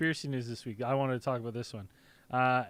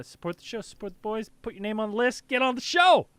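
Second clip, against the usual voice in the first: hyped-up plug; 6 semitones higher, 3 dB louder than usual. Real, not acted. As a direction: pitch climbing to a loud finish. Host hypes up the sign-up plug.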